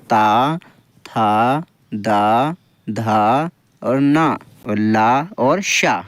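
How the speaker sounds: drawn-out dental syllables, one by one; slow dental demo. Shown as a demonstration. Teacher showing dental letters, slow syllable demo. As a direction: slowly sound out ता, था, दा, धा with the tongue on the teeth. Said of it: "ता वर्ग पूरा".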